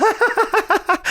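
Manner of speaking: high-pitched real laugh; delighted cackle. Real, not acted. Delighted laugh after the retold climax.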